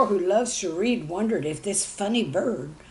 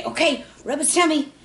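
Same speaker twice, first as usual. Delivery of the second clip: put-on kid instructing; 8 semitones higher, 7 dB louder than usual. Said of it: "someone would say".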